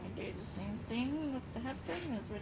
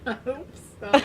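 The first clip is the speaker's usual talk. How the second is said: laughing; 3 semitones higher, 16 dB louder than usual. Real, not acted. Laughing along with partner.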